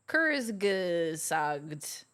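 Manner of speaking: Kurzgesagt sounded out very slowly; slow careful Kurzgesagt. Shown as a demonstration. Friend trying to say the channel name, very slowly. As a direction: stretch out Kurzgesagt syllable by syllable. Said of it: "Who even knows how to say that?".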